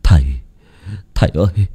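halting, stammered plea to father; struggling to get the words out; faltering mumble. A narrator's reading. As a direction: stumble weakly over the words, with broken pauses. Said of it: "miệng ú ớ".

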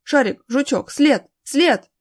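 loud, high tracking commands; separate, urgent calls to dogs; commanding dogs. A narrator's reading. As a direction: urge the dogs onto the scent.

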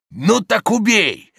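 high, loud, drawn-out challenge; daring shout. A narrator's reading. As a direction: dare him to strike. Judge dares young man to kill. (громкий вызов)

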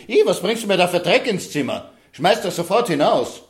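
high, loud disgusted scolding; evil queen. Acted. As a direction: shriek at the muddy gift in disgust. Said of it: "sagte sie".

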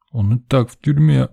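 lightly voiced child holding back tears; tearful boy. A narrator's reading. Boy tells of jailed brother, mildly tearful. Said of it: "вдруг всхлипнул пацанёнок".

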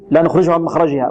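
ordinary delivery

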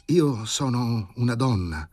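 embarrassed admission. A narrator's reading. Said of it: "e arrossì violentemente".

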